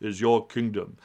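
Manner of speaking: Mufasa voice. Retold or imitated. Mufasa telling Simba about his kingdom, low and slow. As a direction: rumble it out like a lion king. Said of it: "and Mufasa says".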